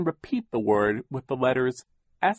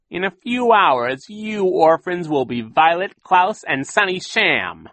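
gloating villain; 11 semitones higher, 9 dB louder than usual. A narrator's reading. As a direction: savour every word of the win. Captain Sham gloating.

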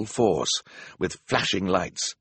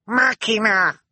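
very high, loud little shriek; 13 semitones higher, 5 dB louder than usual. A narrator's reading. Sunny alerting the others to rescue, shrieking. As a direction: shriek it out like a small child. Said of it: "Sunny shrieked".

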